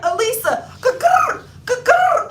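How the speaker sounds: very high, loud cuckoo call; calling like a bird. Retold or imitated. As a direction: sing out cuckoo like a clock bird. Calling out to cousin.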